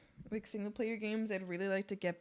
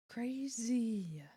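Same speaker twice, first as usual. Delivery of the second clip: soft breathy whisper; whispered crazy; 6 dB quieter than usual. Real, not acted. Streamer amazed at the frog, whispering. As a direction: breathe the word in awe.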